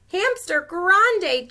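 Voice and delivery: high, slow strained groan. Acted. Chinchetta straining to haul Tank. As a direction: groan under the hamster's weight. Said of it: "Chinchetta groaned".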